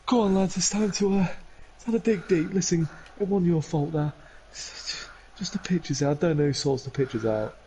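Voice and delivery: put-on rally the boys, pitched high; encouraging manager. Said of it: "the manager's like".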